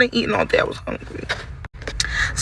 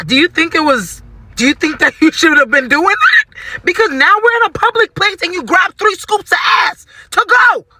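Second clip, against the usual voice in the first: high-pitched, indignant yell; 8 semitones higher than usual. Retold or imitated. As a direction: build from incredulous question to full outraged shout. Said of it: "Oh my God, I'm yelling".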